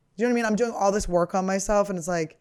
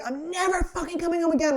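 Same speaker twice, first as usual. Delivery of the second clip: higher, bratty mock yell at her family; retold angry outburst; 10 semitones higher than usual. To her parents after an all-nighter, a high mock tantrum. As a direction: replay the blow-up, pitched high and defiant. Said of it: "I was like".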